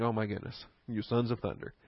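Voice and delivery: a tired teacher shaking his head at hotheads; exasperated sigh. Retold or imitated. Jesus exasperated with his disciples.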